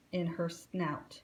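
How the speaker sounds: plain reading voice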